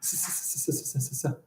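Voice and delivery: repeated soft "s" sounds, hinting at a word; sounding out s. Shown as a demonstration. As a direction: hiss a quick string of "s, s, s" as a clue. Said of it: "It begins with s".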